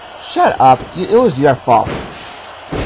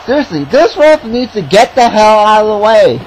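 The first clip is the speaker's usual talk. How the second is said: raised, high-pitched complaint; exasperated rant; 7 semitones higher, 4 dB louder than usual. Real, not acted. Commentator fed up with the ref in the way, exasperated rant. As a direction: protest loudly at the ref's meddling. Complaining about the referee's interference.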